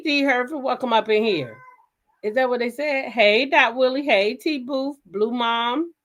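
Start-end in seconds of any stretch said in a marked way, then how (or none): none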